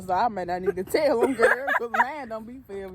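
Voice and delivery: loud, laughing through the words. Real, not acted. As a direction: laugh while saying it. Laughing at own admission.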